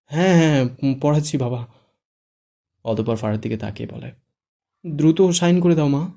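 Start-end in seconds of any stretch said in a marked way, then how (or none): 0.0-1.7 nervous placating yes, louder; hurriedly appease the angry groom; a touch of forced cheer; fake smile. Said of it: "কাজী মেকি হেসে বলল". 4.9-6.1 higher, louder, slow urging; urging old cleric. Acted. Cleric hurries the bride.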